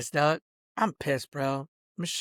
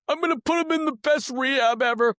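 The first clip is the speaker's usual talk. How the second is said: higher, mocking imitation of Barry; 8 semitones higher, 4 dB louder than usual. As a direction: mimic him sneeringly.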